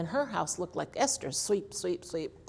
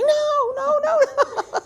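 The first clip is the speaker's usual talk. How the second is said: high, loud laughing "no, no, no"; alarmed no; 10 dB louder than usual. Retold or imitated. Mother reliving calling out as her son tackled the old lady, high and laughing. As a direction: cry out in mock panic from the porch. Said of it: "I'm standing on the porch going".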